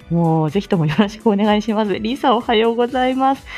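usual speaking voice

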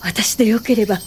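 ordinary talk, nothing marked

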